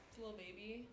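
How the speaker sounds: normal talking voice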